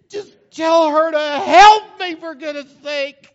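very loud, high, slow pleading; exasperated insistence. Acted. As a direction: plead hard, almost yelling. Martha insisting.

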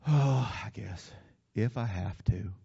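slow, low, sighing reluctance; grudging, unemphatic. Shown as a demonstration. Preacher showing reluctant obedience, slow grudging sigh. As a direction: give in with a heavy "oh". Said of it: "here's another one".

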